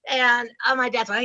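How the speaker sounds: ordinary delivery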